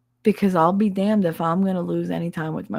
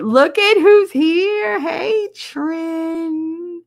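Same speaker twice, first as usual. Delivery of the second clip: high, slow cooing hello; sweet high welcome; cooing greeting; 11 semitones higher, 6 dB louder, much slower than usual. Real, not acted. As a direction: coo delightedly at a newcomer. Greeting someone arriving.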